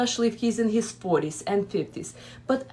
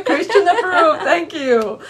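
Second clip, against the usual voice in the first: laughing; 8 semitones higher, 7 dB louder than usual. Real, not acted. Echoing guest's joke, amused.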